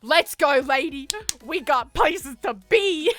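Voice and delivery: mock-bossy hurry shout; urgent shout. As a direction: boom it to rush her. Hurrying mum again.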